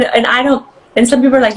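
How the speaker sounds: usual speaking voice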